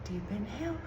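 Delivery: mock instructor, high held note; sing-song exercise class cue; Pilates instructor. Nurse coaching patient through the x-ray, Pilates instructor voice. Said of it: "like I was in a Pilates class".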